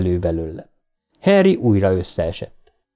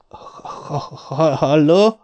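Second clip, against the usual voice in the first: stuttering, wary hello; suspicious stammer; 5 semitones higher, much slower than usual. A narrator's reading. Bill answering a night call, wary and stuttering. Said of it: "gyanakvással beleszólt".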